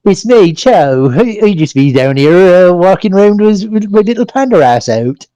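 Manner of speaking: put-on Somerset accent. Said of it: "I know which accent I can do".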